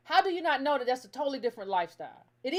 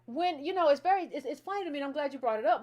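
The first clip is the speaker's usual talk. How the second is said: ordinary talk, nothing marked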